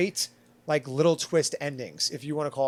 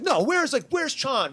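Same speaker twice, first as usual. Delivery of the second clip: much higher, louder panicked demand; freaking out; 10 semitones higher, 3 dB louder, slower than usual. Retold or imitated. Main character panicking at the dinner party, alarmed cry. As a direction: panic about the missing friend. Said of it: "he freaks out".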